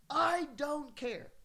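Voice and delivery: high-pitched, insistent dismissal; exasperated retort. Retold or imitated. Housemate dismissing his towel excuse, high exasperated insistence.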